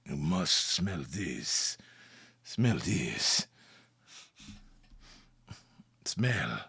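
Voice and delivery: very low, soft, slow urging; mysterious candle seller. Acted. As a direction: coax hypnotically in a deep hush. Candle seller urges a sniff.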